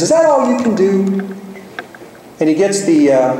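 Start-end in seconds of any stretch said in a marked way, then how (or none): 0.0-1.4 high-pitched parrot squawking out a taunt. Retold or imitated. Parrot heckles the plumber at work, high parrot voice. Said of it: "and the parrot says".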